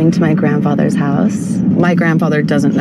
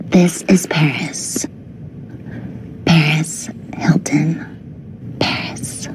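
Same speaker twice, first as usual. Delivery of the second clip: whisper; 7 dB quieter, much slower than usual. Real, not acted. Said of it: "That was your real voice".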